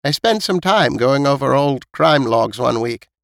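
put-on high-pitched elderly storyteller; elderly neighbour listing past deaths, pitched high; old woman recounting. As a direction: pass on old building gossip in a high old-lady voice. Voices old Missus Knight.

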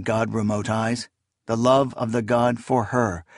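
ordinary delivery

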